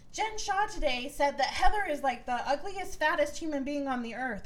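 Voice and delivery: imitating the troll account mocking Heather; mock gossip tweet.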